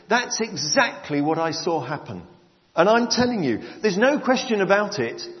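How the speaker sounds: loud, high, full-voiced Bible reading; preaching scripture reading. Preacher reads John's testimony from the Message, loud and proclaiming. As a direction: proclaim the passage to the whole church.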